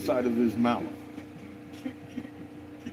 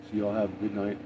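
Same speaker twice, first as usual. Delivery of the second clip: usual speaking voice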